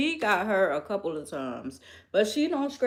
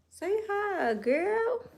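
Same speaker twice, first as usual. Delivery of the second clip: much higher, sing-song coo to the cat; cooing pet voice; 13 semitones higher, much slower than usual. Real, not acted. Coaxing her cat Tootie to say hi, in a high cooing pet voice.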